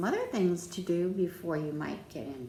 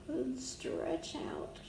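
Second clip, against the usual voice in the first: ordinary delivery, nothing marked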